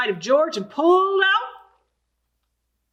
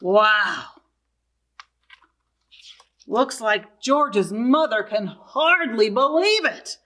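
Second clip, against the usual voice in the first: ordinary delivery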